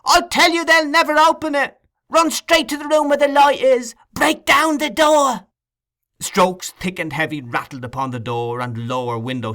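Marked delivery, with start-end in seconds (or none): 0.0-5.5 put-on a boy screaming orders at the crowd; frantic screaming. A narrator's reading. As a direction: keep screaming directions down.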